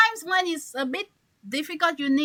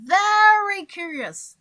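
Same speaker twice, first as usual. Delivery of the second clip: Very punched higher, louder and slow; stress on very; 7 semitones higher, 6 dB louder, slower than usual. Shown as a demonstration. Teacher demonstrating emphasis on Very to the class. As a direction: hammer the word Very. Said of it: "not just curious".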